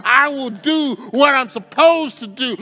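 high, loud preaching climax with a rhythmic cadence; preaching at full force. Acted. Preacher exhorts the congregation at full force, loud and high. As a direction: preach it out, building to a shout.

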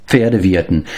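normal talking voice

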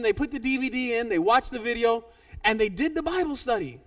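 usual speaking voice

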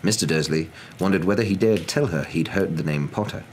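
ordinary delivery

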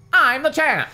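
high, loud, boastful proclamation; bratty high-pitched boast; proclaiming. A narrator's reading. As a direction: crow it like a champion. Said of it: "Lefty proclaimed".

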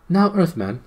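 high, loud princely address; commanding prince. A narrator's reading. Prince addresses the captured gnome, commanding. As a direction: call the prisoner to attention.

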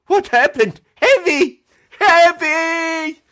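high, loud call to a frozen co-host. Real, not acted. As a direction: shout his name to get him back. Co-host's stream froze, calling him.

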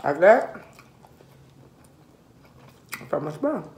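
talking between bites; chewing while talking. Real, not acted. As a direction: mouth partly full. Seen 4: Bites crawfish at 2.1s, then chews with mouth closed while peeling.

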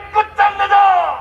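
high slow slogan shout; rally shout. Real, not acted. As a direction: drag out the battle cry. (끝장내자 구호 외침)

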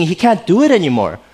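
ordinary talk

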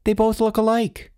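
put-on high desperate child's voice; high-pitched boyish desperation; desperate blurting. A narrator's reading. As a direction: insist like a distressed little boy. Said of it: "Charles blurted out desperately".

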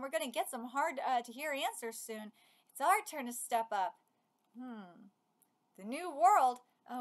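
ordinary delivery

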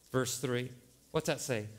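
ordinary talk

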